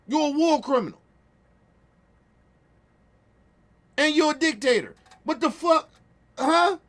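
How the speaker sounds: high, loud furious accusation; angry accusation. Real, not acted. Streamer calling Putin a war criminal, high angry shout. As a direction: throw the charge in their face, loud.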